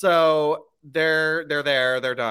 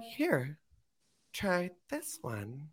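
sly soft offer; 12 dB quieter, much slower than usual. Retold or imitated. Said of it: "Tori was probably like".

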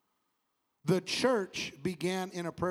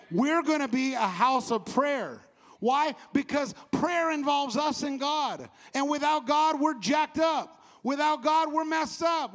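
high, loud, slow preacher's build; preaching at full force; 6 semitones higher, 4 dB louder than usual. Acted. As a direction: preach it out to the congregation.

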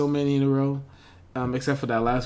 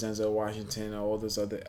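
ordinary delivery

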